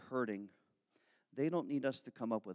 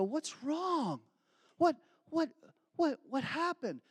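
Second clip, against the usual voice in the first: much higher, urgent caring questions; worried concern; 12 semitones higher, slower than usual. Retold or imitated. Someone kneeling to a person who fell, worried and high. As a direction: rush to someone fallen at your feet. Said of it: "You're gonna go".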